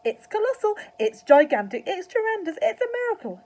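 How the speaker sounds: high, loud building raptures; dance around shouting; ecstatic, soaring exclamations; excited shouting. A narrator's reading. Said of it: "Mister Cranky shouted".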